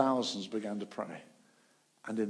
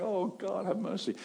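high pleading cry; 9 semitones higher than usual. Retold or imitated. Praying crowds, voiced high, pleading for mercy. People crying out in revival.

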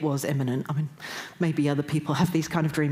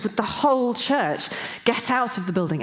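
higher-pitched, pressing command; urgent authoritative; 6 semitones higher than usual. Retold or imitated. Woken by this voice in the dream, urgent and authoritative. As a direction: retell it as an urgent order. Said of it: "it was really urgent".

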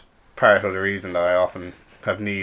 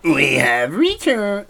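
slow, high, louder robot announcement; botched robot voice; 8 semitones higher, 5 dB louder, much slower than usual. Pretend. Host announces the return after a break, botched robot voice. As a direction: attempt a robot, landing near Yoda. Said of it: "like, a robot voice".

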